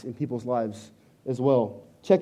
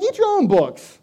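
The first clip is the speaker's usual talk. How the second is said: high, put-on snippy wife voice; retelling wife's refusal in a high mock voice; mock wife retort; 13 semitones higher, 6 dB louder than usual. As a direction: toss it back, lightly mocking her. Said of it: "And she's like".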